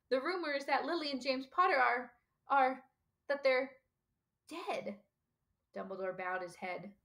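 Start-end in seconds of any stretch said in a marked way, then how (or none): 0.1-5.0 hesitant, pained, trailing to hushed; dreading the news. A narrator's reading. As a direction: falter before the awful word.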